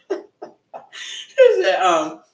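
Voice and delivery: high, breaking into laughter; laughing. Real, not acted. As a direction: laugh through it. Laughing retelling.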